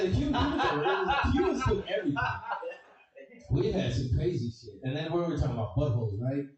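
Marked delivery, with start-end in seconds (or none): 0.0-2.2 laughing brag. Real, not acted.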